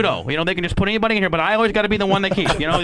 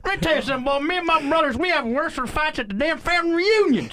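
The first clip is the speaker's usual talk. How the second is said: southern twang; 11 semitones higher than usual. Shown as a demonstration. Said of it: "in a southern twang".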